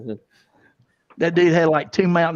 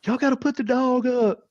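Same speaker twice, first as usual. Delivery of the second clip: much higher mock suspect voice; imitated cornered suspect; 10 semitones higher than usual. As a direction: imitate him bargaining from hiding, pitched high. Retold suspect pleading from playhouse.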